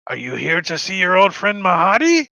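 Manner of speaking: put-on high, loud Indian-accented question. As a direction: ask loudly in an Indian accent. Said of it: "And then he's like".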